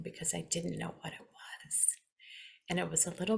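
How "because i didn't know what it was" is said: whispered aside; soft unvoiced confiding whisper. Real, not acted.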